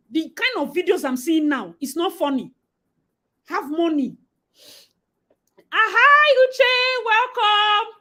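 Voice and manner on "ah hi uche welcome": high, loud welcome; greeting a viewer warmly; excited greeting. Real, not acted. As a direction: sing out hello to a new arrival.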